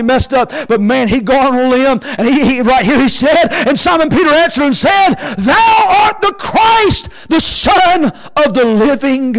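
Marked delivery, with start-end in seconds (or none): 3.5-9.3 high, long-held preacher's shout; full-force preaching. Acted. Preacher voices Peter's confession to Christ, full-force preaching. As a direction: cry the confession out, stretching every word.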